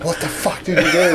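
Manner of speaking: high, half-laughing mock yell; mock outburst. Dad retelling his reaction to the slime mess, laughing mock yell. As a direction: replay the startled angry dad line with a laugh. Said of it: "my first reaction is like".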